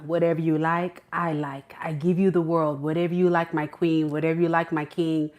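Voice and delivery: low, hushed devoted suitor; movie-quote impression. Person wooing Scorpio with promises, low and devoted. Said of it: "Next plan is coming to America".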